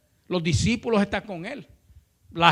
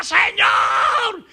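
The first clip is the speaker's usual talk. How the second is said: relentless high shout, words stretched slow; loud, high begging from far behind; crying out repeatedly; 11 semitones higher, 8 dB louder, slower than usual. Retold or imitated. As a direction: yell the plea insistently, dragging it out. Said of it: "no había terminado bien la frase cuando lo oía".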